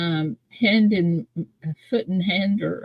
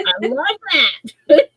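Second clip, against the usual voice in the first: delighted; 9 semitones higher, 4 dB louder, much slower than usual. Real, not acted. Delighted reaction.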